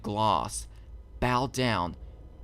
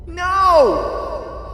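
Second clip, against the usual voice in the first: high, loud anguished scream; full-throated high scream of despair; 10 dB louder than usual. A narrator's reading. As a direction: scream at the empty cell. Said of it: "Twilight screamed".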